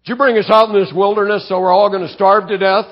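high, loud, fast whining complaint; grumbling accusation. Retold or imitated. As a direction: rattle off the accusation like a fed-up crowd. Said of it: "The whole congregation is grumbling about".

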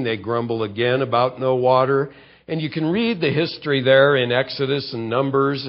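ordinary talk

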